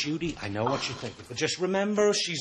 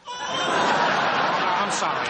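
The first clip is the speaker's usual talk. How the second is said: "I'm sorry" forced out through loud laughter, slow; laughing apology; 2 semitones higher, 6 dB louder, much slower than usual. Real, not acted. Husband breaking into laughter mid-mockery, laughing apology. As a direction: apologise while still cracking up.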